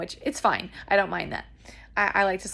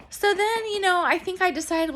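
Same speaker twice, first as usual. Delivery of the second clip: ordinary delivery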